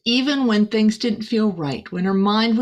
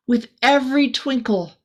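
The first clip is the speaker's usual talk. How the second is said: plain reading voice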